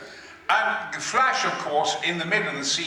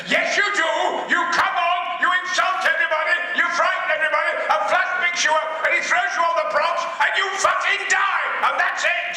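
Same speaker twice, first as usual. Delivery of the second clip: hot-tempered shout; 10 semitones higher, faster than usual. Retold or imitated. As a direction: blow up at a stubborn actor. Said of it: "could get quite hot-tempered".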